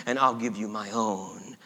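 soft, low, gentle promise; hushed and compassionate; tender pity. A narrator's reading. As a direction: offer it quietly to a hurt child. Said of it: "looked upon this child with pity".

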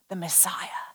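low, breathy near-whisper; hushed reveal. Real, not acted. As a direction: whisper it in awe. Hushed reveal of who is coming.

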